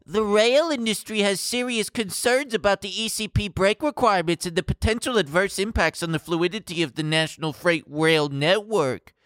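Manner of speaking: mock exec reading to lawmakers; quoting executive. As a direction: read the quote like a stiff executive. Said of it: "He told lawmakers, quote".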